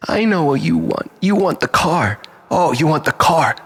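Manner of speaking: normal talking voice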